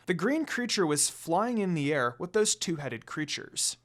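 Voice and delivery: ordinary delivery, nothing marked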